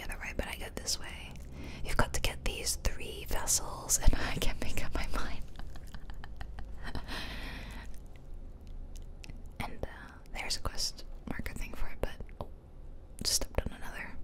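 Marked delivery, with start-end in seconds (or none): 5.5-9.6 long giggle on the breath; hushed, voiceless chuckling; whispered laughter. Real, not acted. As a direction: laugh it out without any voice. Laughing at own indecision.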